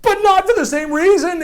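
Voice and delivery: usual speaking voice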